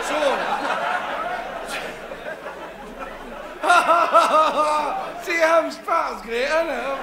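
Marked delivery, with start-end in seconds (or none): 0.2-5.2 high loud helpless laugh; loud laughter. Real, not acted. Comic breaks up on stage, loud laughter. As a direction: burst out laughing at his own joke.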